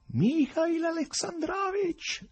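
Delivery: high, slow drawn-out call. Acted. Professor calling after the departing Berlioz, long high call. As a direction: call after him across the park. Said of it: "gridò alle spalle di Berlioz".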